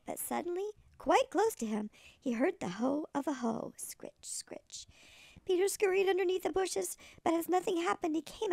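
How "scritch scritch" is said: whispered scratching sound. Acted. Hoe scraping sound imitated.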